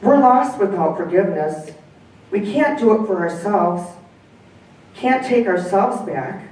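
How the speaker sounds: story reading. Acted. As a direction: read aloud with lively dialogue, dog and man voiced lightly. Reads the story aloud.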